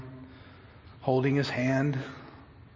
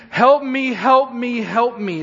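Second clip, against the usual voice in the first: loud, high begging, easing with each repeat; urgent repeated plea; imploring; 7 semitones higher, 7 dB louder, slower than usual. Acted. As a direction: plead desperately at the feet of a healer. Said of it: "he began imploring him".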